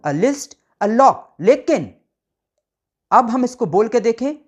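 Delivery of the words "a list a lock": drawn out slowly, each article stressed; slow teaching demo. Shown as a demonstration. Teacher models the article slowly for students. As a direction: read it out slowly for the class. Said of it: "तो a lion".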